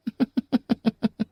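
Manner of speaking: a sudden, high, mocking giggle; high teasing laugh. Teasing the officer about his eagerness, high giggle. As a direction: laugh at him as if he's caught out.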